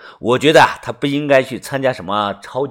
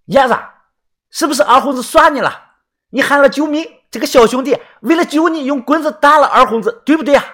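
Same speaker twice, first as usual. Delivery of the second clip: high, raised villager voice; loud questioning; 10 semitones higher, 3 dB louder, faster than usual. A narrator's reading. Villager prompts Yezi before the crowd, high raised voice. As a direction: call out pointedly for all to hear. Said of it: "又大声的说".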